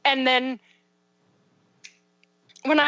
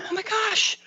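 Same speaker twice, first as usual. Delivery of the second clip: put-on high, bright praise for a child; excited parent praising her son; delighted praise; 11 semitones higher than usual. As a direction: gush over the kid, pitched well up. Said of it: "my husband and I were like".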